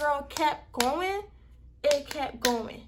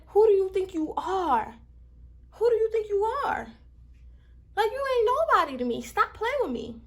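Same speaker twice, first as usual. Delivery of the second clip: higher and louder, fed-up outburst; real anger; 7 semitones higher, 4 dB louder than usual. Real, not acted. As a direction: demand who she thinks she is. Venting anger at coworker again.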